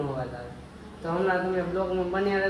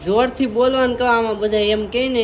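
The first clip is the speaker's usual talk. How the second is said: a higher, louder show of speaking up; speaking loudly on purpose; 7 semitones higher, 6 dB louder than usual. Shown as a demonstration. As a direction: prove to the viewers he can talk loud. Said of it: "એમ કહે કે જોરથી નથી બોલતો".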